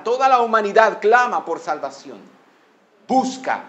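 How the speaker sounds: loud, high, impassioned preaching; prédica enérgica. Real, not acted. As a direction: proclaim it forcefully to the congregation. Preaches with raised force. (predicación ferviente, con voz elevada)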